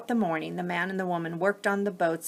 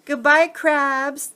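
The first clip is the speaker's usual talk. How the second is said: slow, louder farewell call across water; calling goodbye; 8 semitones higher, 8 dB louder, slower than usual. Acted. Bowie, Seal and Gull call farewell to the crabs, slow loud call. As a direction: send it after the departing boat.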